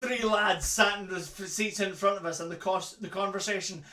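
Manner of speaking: ordinary talk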